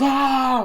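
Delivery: loud high yell; startled yell. Retold or imitated. Dewey with the gun, startled loud yell. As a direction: yell like you've been jumped.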